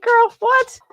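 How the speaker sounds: high, loud squeal of disbelief; mock disbelief. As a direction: act out a stunned reaction. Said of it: "I was like".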